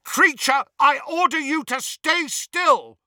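loud, high commanding roar; Harry overpowering the struggling elf with a loud roar; bellowing. A narrator's reading. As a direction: bellow the order over the screaming. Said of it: "Harry bellowed louder than both".

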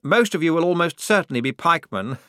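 high, loud, flustered officer voice; nervous. A narrator's reading. Lieutenant admitting the lack of training, nervously jolly. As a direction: bluster through it with nervous cheer. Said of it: "said the lieutenant nervously".

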